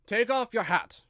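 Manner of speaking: a king scolding his subject; loud reproving royal voice; stern king. A narrator's reading. King commands.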